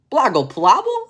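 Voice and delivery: put-on high, loud baby gibberish; baby babble. Baby answers the adults in high loud babble. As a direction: babble like a happy toddler. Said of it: "said the baby".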